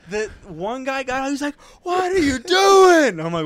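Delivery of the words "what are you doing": drawn-out, high-pitched shout; imitated yelling. Border guard yelling at a traveler on the phone, shouting. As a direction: yell it slowly and high, mimicking an angry guard. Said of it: "this guy keeps on yelling".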